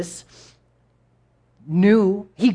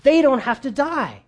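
higher, urgent plea to Pharaoh; pleading; 6 semitones higher, 4 dB louder than usual. Retold or imitated. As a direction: beg him to give in. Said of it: "he's like".

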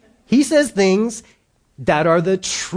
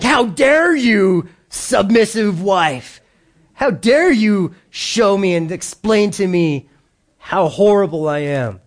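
mock offended husband; 2 semitones higher, slower than usual. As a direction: ham up the wounded husband's indignation. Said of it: "we, we're like".

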